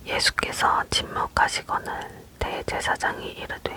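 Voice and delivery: plain reading voice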